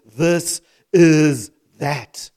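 THIS... IS... THAT, each word drawn out and loud; slow emphatic quote. Shown as a demonstration. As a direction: hammer each word of "This is that" very slowly. Preacher hammers the quoted phrase word by word.

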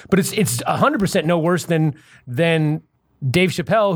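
ordinary delivery

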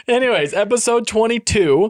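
high, loud, punchy restart; loud announcement. Acted. Host gets the show back on track, announcing loudly. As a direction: belt out the episode title.

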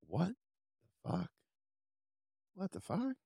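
as if the wind got knocked out of him; stunned disbelief. Retold or imitated. Stunned by February appointment.

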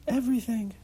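put-on high child's voice, sulking; sulky child. Child answers what makes her angry.

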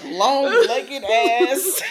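slow and laughing, drawn out; laughing repeat of the line. Retold or imitated. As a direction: relish the phrase while cracking up.

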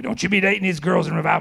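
loud, forceful imitation of a scolding elder; stern warning. Older preacher warning young evangelist, imitated stern warning. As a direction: boom it out as an emphatic warning.

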